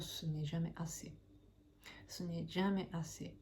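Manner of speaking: ordinary talk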